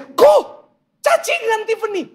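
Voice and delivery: high-pitched panicked outcry; panicked wife. Retold or imitated. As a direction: cry out in alarm, very high. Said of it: "karena dia panik".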